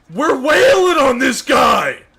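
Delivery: high, loud, slow outraged yell; frustrated shout. Real, not acted. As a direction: shout it in disbelief. Frustrated in a fight.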